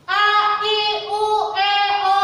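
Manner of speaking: A, I, U, E, O, each vowel distinct and drawn out; clear articulation. Shown as a demonstration. Teacher demonstrating pelafalan to the class, A, I, U, E, O clearly articulated. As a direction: sound out A, I, U, E, O clearly, pitched slightly up. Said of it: "harus jelas".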